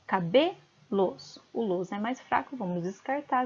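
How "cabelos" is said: "Cabelos" slow, BE stressed, "los" split off; "Cabelos" as caBÊ, break, los. Shown as a demonstration. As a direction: lean on "be", pause, then a separate "los". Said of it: "vamos contar até o B".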